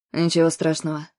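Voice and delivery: low, pleasant man's voice; velvety male voice. A narrator's reading. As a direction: soothe her with a deep, velvety tone. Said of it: "приятным бархатистым голосом". (бархатистый мужской голос)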